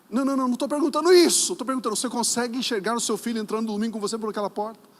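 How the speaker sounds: high, fast, loud cut-in; insistent interruption. Acted. Pastor cuts off the mother's excuse, fast insistent voice. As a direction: rush in over the objection, starting very loud.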